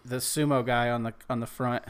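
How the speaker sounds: normal talking voice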